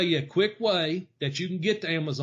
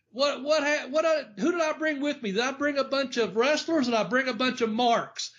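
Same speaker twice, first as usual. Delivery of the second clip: mock yelling back; 7 semitones higher than usual. Wrestler calling back to the locker room, mock yell. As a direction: imitate someone calling back across a room. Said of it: "he yells back, he goes".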